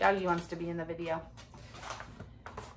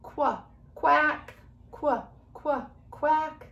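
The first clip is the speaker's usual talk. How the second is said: playful quacks, jumping higher on each quack; duck quacking; 6 semitones higher, 4 dB louder, much slower than usual. Acted. Teacher voicing Quacky the duck for her class. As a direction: quack like a chatty little duck. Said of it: "She would say".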